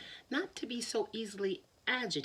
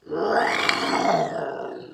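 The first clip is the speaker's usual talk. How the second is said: breathy roar; 3 semitones lower, 9 dB louder than usual. Acted. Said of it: "the lion went".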